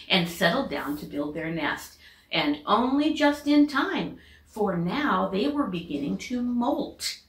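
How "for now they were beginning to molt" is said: plain reading voice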